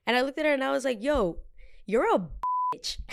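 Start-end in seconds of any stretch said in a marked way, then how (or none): 1.0-3.0 acted-out confrontational jab; retold confrontation. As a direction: space out the insult, pitched up. Retelling her confrontation, insulting the woman.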